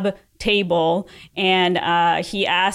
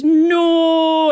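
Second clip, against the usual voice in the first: loud, high, long-held monotone wail; James Earl Jones as Darth Vader; 9 semitones higher, 6 dB louder than usual. Retold or imitated. Critic mocks the scene's final cry, monotone and drawn out. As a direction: hold one raised note, loud and unwavering. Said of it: "most monotone, weirdest delivery of James Earl".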